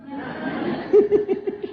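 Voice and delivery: a high airy burst of laughter; high breathy laugh. Real, not acted. Preacher laughing at his own joke to the congregation, high airy laugh. Laughs after punchline "Mati.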